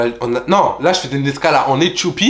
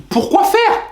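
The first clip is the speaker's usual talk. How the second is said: very high incredulous cry; outraged disbelief; 4 dB louder, much slower than usual. Retold or imitated. Nephew reacting to bank cancelling transfer, shrill disbelief. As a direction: shriek the question in disbelief.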